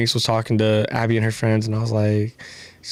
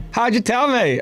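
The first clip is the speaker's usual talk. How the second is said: higher-pitched mock Aussie reply; Australian accent; 10 semitones higher than usual. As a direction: answer in a put-on Australian accent.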